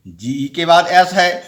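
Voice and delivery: ordinary talk, nothing marked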